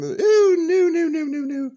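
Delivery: high, loud hammed-up no; mock wail of refusal. Peachy refuses to pass on her VIP name, mock wail. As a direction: wail the no's in mock horror.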